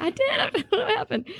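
sheepish laughing defense; laughing excuse. Retold or imitated. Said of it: "And I was like".

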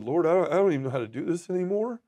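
high, helpless plea to God; pleading prayer. Retold or imitated. Man praying alone in his cell, pleading. Retold desperate prayer in jail.

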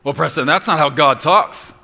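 loud high mock protest; objector. Imagined listener protesting to preacher Preston, loud mock objection. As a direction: push back like an offended listener.

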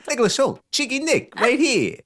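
high, cheeky self-introduction; cheeky Nick Hoult. Pretend. Guest playing the actor, high and cheeky. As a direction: pop in as a cheeky chappy.

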